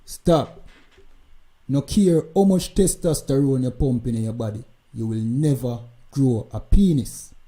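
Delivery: ordinary talk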